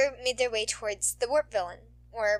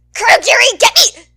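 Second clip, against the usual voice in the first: very loud, much higher desperate cry; panicked scream; 12 semitones higher, 21 dB louder than usual. Acted. Dragged villain shrieking for Kurogiri to save him, panicked scream. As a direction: shriek for rescue.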